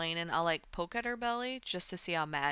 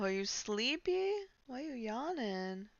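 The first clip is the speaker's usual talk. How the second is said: cooing; 5 semitones higher than usual. Real, not acted. Cooing at yawning cat.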